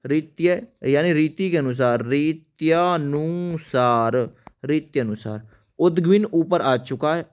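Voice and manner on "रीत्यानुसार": रीत्यानुसार drawn out slowly; slow demo. Shown as a demonstration. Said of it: "पहले लिखिए रीत्य". (रीत्यानुसार धीरे-धीरे)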